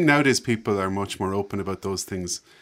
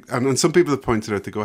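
normal talking voice

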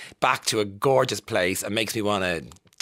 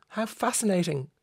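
mock fascination. Real, not acted. DJ teasing listeners about her own trivia, high and mocking. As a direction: voice climbs high on each question, drops for the answer.

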